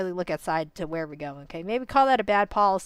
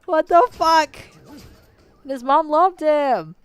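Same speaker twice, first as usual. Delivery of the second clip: high, loud, drawn-out outrage; outraged cry; 7 semitones higher, 8 dB louder, much slower than usual. Real, not acted. Viewer cries out for the mother, loud and outraged. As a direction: shout it at the screen in disbelief.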